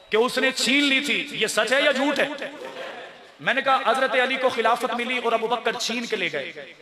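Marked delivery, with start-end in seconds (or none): none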